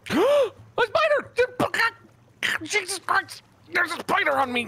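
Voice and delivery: high shrieking panic; screaming at a spider. Real, not acted. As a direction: shriek in sudden fright. Spider attacks in game.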